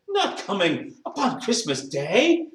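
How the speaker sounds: high, slow, incredulous question; dismayed disbelief. A narrator's reading. Bob hears Martha is missing Christmas, dismayed disbelief. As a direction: draw it out, unable to believe it. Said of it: "with a sudden declension in his high spirits".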